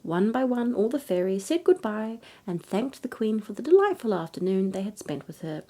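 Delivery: ordinary delivery, nothing marked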